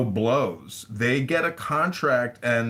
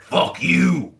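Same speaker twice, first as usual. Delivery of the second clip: gruff retort; 5 semitones higher, 6 dB louder than usual. Retold or imitated. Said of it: "And he says".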